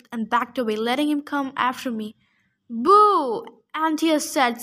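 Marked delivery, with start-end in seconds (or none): 2.7-3.4 loud long boo; heckling boo. Acted. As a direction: jeer it from the stands. Crowd leader booing fighter.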